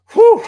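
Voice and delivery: loud, high sigh of relief; relieved whew. Real, not acted. Host exhales loudly in relief after thanking viewers. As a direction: blow out the tension after the emotional thanks. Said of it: "I made it through".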